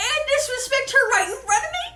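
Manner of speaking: high, loud furious tirade; king yelling at Haman for grabbing his wife; mock outraged king. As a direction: rant at him in mock outrage. King's jealous fury.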